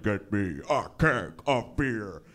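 low, slow movie growl, kept soft; very bassy. Acted. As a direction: drop it deep and drag out each word. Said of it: "very bassy".

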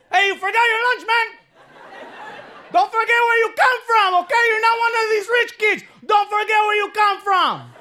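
high shouting father; dad shouting reminder. Retold or imitated. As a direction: yell it across campus so everyone hears. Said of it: "My dad's like".

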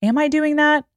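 hushed start, then a high questioning lift; acted moment of self-doubt, rising high; softened self-questioning. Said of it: "learn to soften and be like".

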